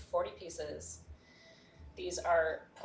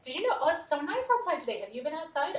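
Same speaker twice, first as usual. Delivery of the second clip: higher, louder mock small talk; hammed-up bubbly chatterbox; chatty babble; 6 semitones higher, 6 dB louder than usual. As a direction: gush on relentlessly at a silent listener. Acting out relentless chatter at silent suppliers.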